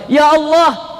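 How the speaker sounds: high cry to God; high, fervent exclamation; anguished invocation. Acted. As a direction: call out to God from on high pitch. Prophet cries out to God.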